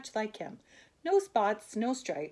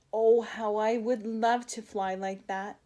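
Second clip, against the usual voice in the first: longing wish; 3 semitones higher, 5 dB louder than usual. Acted. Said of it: "Hermie wished".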